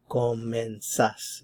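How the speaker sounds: comenzás drawn out slowly, stress on final "zás"; stress on last syllable. Shown as a demonstration. Teacher showing the vos form, slow comenzás stressed at the end. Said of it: "The stress is on the last syllable".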